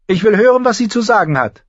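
high, loud order; royal command. A narrator's reading. King Joffrey orders silence for Sansa, commanding. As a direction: command the court to let her speak. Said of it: "befahl er".

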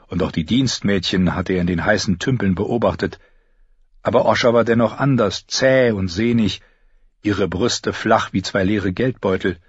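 plain reading voice

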